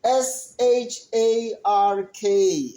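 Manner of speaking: slow letter-by-letter spelling; slow, deliberate spelling for young learners. Shown as a demonstration. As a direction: spell each letter slowly for the class. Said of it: "the shark is spelled".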